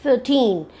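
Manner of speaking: put-on "thirteen" with a stretched, falling TEEN; long second syllable. Shown as a demonstration. Teacher telling students how to pronounce thirteen, second syllable prolonged. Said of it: "prolong the second syllable while pronouncing".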